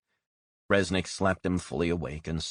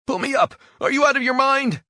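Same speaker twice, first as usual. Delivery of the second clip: very high, loud screaming plea; terrified scream; 10 dB louder than usual. Acted. Man dangling head first in silo, terrified scream. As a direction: scream for your life.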